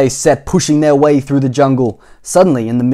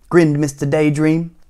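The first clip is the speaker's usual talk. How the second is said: plain reading voice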